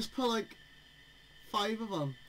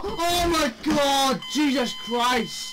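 loud, slow, terrified cry; 3 semitones higher, 9 dB louder, slower than usual. Real, not acted. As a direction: panic as it closes in. Terrified at chase.

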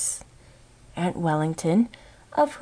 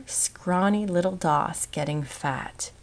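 usual speaking voice

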